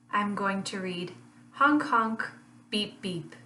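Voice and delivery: ordinary delivery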